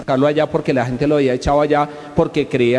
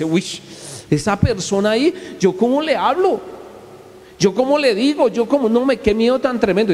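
mock fear; 8 semitones higher than usual. Person frightened before an important listener, mock fearful. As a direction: act out stage fright.

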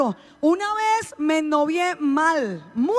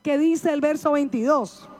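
ordinary talk

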